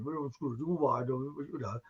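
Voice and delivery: a hint of a calming cinema manager. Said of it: "the manager comes along and says".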